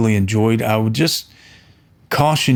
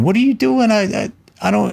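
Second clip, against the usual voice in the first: much higher, irritated mock outburst; annoyed strangers; 10 semitones higher than usual. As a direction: snap at the noisy neighbours, imitated. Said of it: "start yelling at us for us to shut up".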